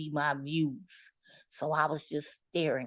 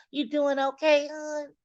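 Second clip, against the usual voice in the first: sugary nurse voice; 8 semitones higher than usual. Retold or imitated. Imitating nurse.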